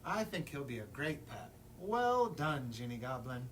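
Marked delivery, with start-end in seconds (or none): none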